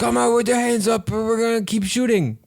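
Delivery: loud, high cop yell; cop shout. Retold or imitated. Acts cop ordering surrender.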